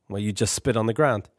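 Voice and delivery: lightly voiced patient older brother.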